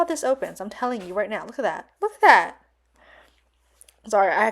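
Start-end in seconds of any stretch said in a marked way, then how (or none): 2.0-2.6 excited. Real, not acted. Admiring the box, repeated excitedly.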